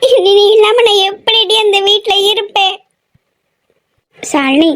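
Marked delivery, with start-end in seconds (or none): 0.1-2.8 high, slow tearful wail; crying. Acted. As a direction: wail through sobs to a departing sister. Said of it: "அழாத". (அழுதுகொண்டே புலம்பல்)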